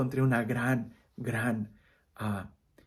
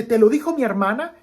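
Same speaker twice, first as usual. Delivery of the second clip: angry; 7 semitones higher, 7 dB louder than usual. Acted. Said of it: "el niño se enoja".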